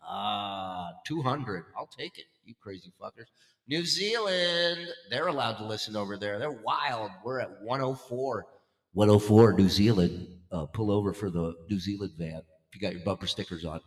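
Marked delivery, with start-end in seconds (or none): 3.7-4.9 stretched slow, pitched up for the country; drawn-out announcer call. Acted. 8.9-10.3 big booming countdown call; loud announcer call. Acted. Countdown announcement, much louder.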